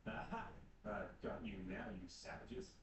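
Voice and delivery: low, slow villain's gloat; deep gloating customs man; gloating official. Acted. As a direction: pounce on them like a pantomime baddie.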